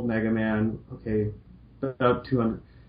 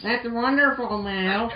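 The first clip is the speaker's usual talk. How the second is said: put-on higher, bright girl-robot voice; cheerful Roll voice; 12 semitones higher, slower than usual. Roll greeting Mal cheerfully in a higher voice. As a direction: play sweet Roll greeting her friend. Roll's voice.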